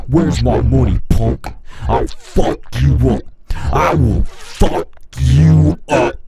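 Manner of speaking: hammed-up tough-guy threat; mock gangster threat. Playing with the voice effect, a mock thug's loud threat. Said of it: "It should just be the low voice".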